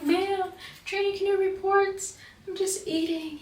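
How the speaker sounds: high-pitched protest to a superior; high, retold trainee plea; imitated pleading. Retells pleading with instructor.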